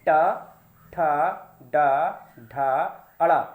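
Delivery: ठा, डा, ढा, अड़ा drawn out one by one, very slow; slow drill. Shown as a demonstration. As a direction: spell each sound out slowly for the class to copy. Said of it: "मेरे साथ आप कहेंगे".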